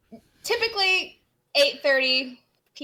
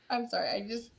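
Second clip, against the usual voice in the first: ordinary talk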